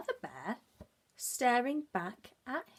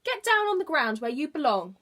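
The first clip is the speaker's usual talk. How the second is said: higher, louder scolding; cross bird; 6 semitones higher, 8 dB louder than usual. Acted. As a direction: tell him off loudly from the nest. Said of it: "squawked the bird".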